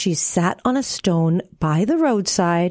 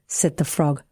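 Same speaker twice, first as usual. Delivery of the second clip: ordinary delivery, nothing marked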